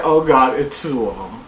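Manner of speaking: low, horrified mutter; mock dread. Himself seeing two of them at the door, low mock dread. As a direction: sound spooked and ready to bolt. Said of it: "I was gonna be like".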